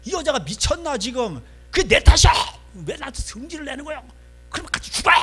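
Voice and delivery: high-pitched, explosive one-man-drama rant; losing his temper. Acted. Jacob blaming Rachel right back, furious and high-pitched. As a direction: erupt at your wife in high bursts. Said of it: "제가 지금 모노 드라마 하고".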